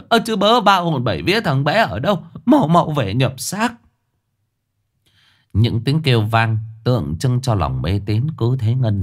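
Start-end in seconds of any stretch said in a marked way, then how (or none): 0.1-3.7 wailing call. A narrator's reading. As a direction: cry it out to all four directions. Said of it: "hú lên thảm thiết".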